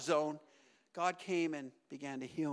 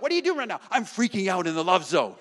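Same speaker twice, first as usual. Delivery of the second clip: loud, hammed-up freak-out; 9 dB louder, faster than usual. Preacher demonstrating freaking out in the love zone, loud mock freak-out.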